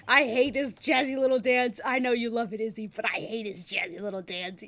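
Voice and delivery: loud playful rant, easing off; exasperated but amused; laughing annoyance. Real, not acted. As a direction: rant loudly, then settle. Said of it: "Makes me so mad".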